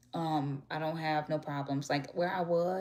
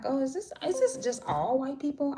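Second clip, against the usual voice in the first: surprised question; 8 semitones higher than usual. Retold or imitated. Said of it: "And I'm like".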